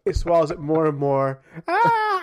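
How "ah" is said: high, loud frog squeal; quick screech like a caught frog; louder frog shriek; imitated frog scream. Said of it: "it just sounds just like that".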